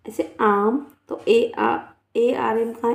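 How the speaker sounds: ordinary talk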